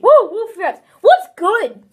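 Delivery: loud, high hype greeting; hyped youtuber intro. Retold or imitated. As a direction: shout it like a pumped youtuber. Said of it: "impressions or anything".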